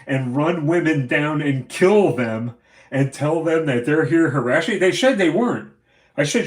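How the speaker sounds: real fury building to a near shout; furious rant. Real, not acted. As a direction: yell it, outraged at the police. Furious rant about police.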